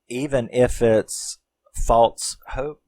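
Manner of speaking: normal talking voice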